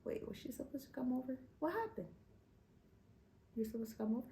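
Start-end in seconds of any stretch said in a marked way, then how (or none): none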